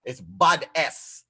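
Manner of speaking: "bad S" drawn out very slowly; slow spelled out. Shown as a demonstration. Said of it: "All right?".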